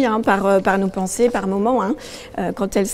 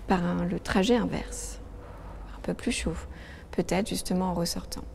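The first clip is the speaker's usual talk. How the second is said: soft, calm meditation voice; guided meditation; 5 dB quieter than usual. Acted. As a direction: guide them gently into their body. Leads a relaxation exercise. (voix de méditation guidée)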